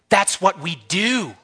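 high, drawn-out proud declaration; fired-up preacher driving it home; worked-up yelling. Real, not acted. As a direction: stretch it out with rising fervour. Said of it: "Sorry about the yelling there".

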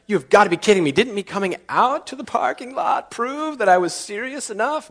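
high, put-on whine of protest; high whining complaint; whiny pleading. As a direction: beg like a sulky kid. Pleading argument with God.

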